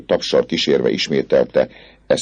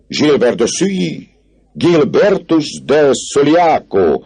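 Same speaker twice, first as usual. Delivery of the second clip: announcing call; 11 semitones higher, 4 dB louder, slower than usual. Acted. Student calls out official's name.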